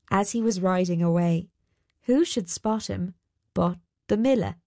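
plain reading voice

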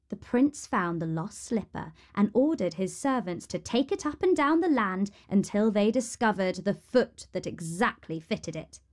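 ordinary delivery

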